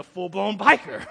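mock-tough shout; playful boast. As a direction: belt it out as a joking boast. Joking boast of being a biker.

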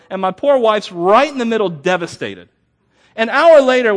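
preacher warming to his story at full volume; rising preaching voice. Acted. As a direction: push the tale up to full pulpit energy. Preacher builds the curtain story.

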